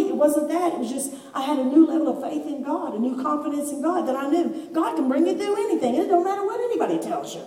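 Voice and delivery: ordinary talk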